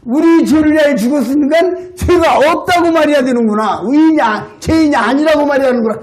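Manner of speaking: high, quickening as the penny drops; dawning realization. Retold or imitated. Old man working out his answer aloud, excited realization. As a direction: think it through aloud, delighted. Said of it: "깨달아지는 거예요".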